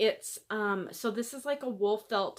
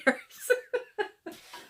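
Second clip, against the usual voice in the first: laugh; 13 semitones higher, 3 dB louder than usual. Real, not acted. Laughing at own slip.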